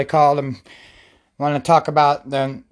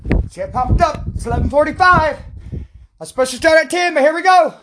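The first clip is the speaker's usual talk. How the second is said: calling from the backyard; 9 semitones higher than usual. Real, not acted. Hyping up in the backyard.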